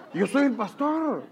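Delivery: high, slow laughing line; amused pastor revealing himself. Retold or imitated. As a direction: laugh as you drop the surprise.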